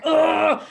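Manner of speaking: loud annoyed groan; groggy groan. Retold or imitated. Woken at four by the puppy, loud grumpy groan. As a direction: groan at being dragged out of bed.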